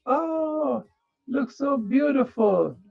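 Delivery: mild mocking coo; mock gushing. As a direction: coo like a shallow admirer. Mocking shallow admirers.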